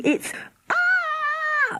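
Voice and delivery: long high steady scream; girl demonstrating a long high scream. Shown as a demonstration. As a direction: show how to scream into the hole. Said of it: "That's how you scream".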